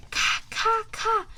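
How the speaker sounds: high, sharp bird caws; magpie caws. A narrator's reading. Magpies in a tree jeer at Mister Croc, sharp caws. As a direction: call out like a magpie. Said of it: "called out sharply".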